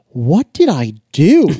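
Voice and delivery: slow, drawn-out mock bewilderment; puzzled, exaggerated self-questioning; baffled wondering. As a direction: wonder aloud, stretching each word. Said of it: "wondering, like".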